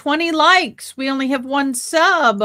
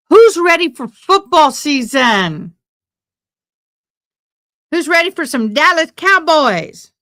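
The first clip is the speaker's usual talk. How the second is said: louder, pumped-up game-day call to the crowd; hype announcer; 3 semitones higher, 5 dB louder than usual. Real, not acted. Streamer to viewers, hyping Cowboys football season loudly. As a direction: get the fans fired up.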